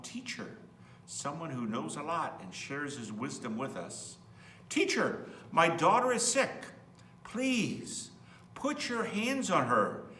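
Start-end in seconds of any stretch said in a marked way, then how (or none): 4.7-10.1 pleading father. Acted. Father begging Jesus for help.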